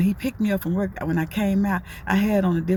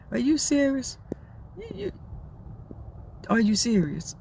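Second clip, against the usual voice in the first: amazed disbelief; 5 semitones higher, 3 dB quieter than usual. Retold or imitated. She reacts to the fat burger.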